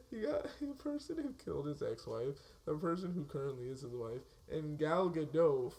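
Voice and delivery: normal talking voice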